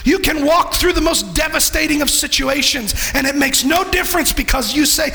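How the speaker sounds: rising, shouted preacher's climax; preaching at full force. Acted. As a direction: build it up and shout the promise.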